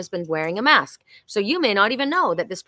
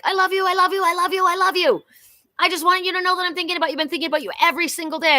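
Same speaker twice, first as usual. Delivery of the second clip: high, fast, breathless gushing I love yous; secret admirer's inner gush; 9 semitones higher, 3 dB louder, much faster than usual. Retold or imitated. Inner voice of a hidden admirer, high and fast gushing. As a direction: gush like someone hiding a crush. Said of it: "When inside they're like".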